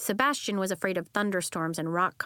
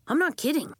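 higher, louder insisting; insistent; 7 semitones higher, 5 dB louder than usual. Acted. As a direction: insist earnestly. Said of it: "he insisted".

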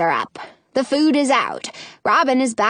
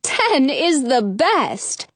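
chiming in; 2 semitones higher, much slower than usual. Acted. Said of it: "chimes in Chasney".

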